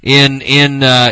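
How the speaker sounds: normal talking voice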